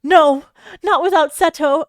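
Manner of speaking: high, loud cry of refusal; desperate shout at the firefighters. Acted. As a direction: refuse to be taken away, loudly. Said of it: "Anzu shouted".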